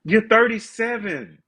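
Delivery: high, slow, disbelieving; incredulous. Retold or imitated. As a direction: spell it out in disbelief. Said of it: "And I'll be like".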